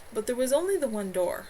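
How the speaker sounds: plain reading voice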